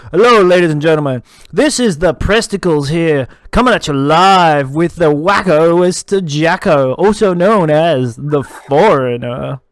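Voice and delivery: slow, drawn-out radio announcer. Acted. Co-host introducing the guest live, radio voice. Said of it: "I am doing my radio voice".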